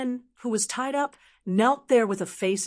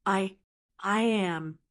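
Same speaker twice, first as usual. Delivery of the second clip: stammering; slower than usual. Acted. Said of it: "Big Bear stammered".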